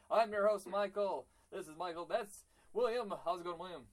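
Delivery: high, loud, hyped-up host announcing; dramatic show intro. Real, not acted. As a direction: open the show with dramatic adrenaline. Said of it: "quite as dramatic as what you just did".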